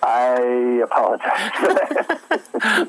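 slow, laughing apology. Real, not acted. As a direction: say it through a chuckle. Apologizes laughing after song.

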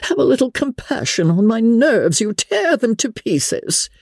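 high-pitched, fretting mother's scolding. A narrator's reading. Mother scolding Kitty for coughing, exasperated. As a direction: scold as if your nerves are in shreds, stretching the ends. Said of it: "began scolding one of her daughters".

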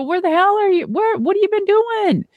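high, amazed retold greeting to an old friend; surprised excitement. To Mike on the phone, high amazed surprise. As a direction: act out the shock of hearing from an old friend.

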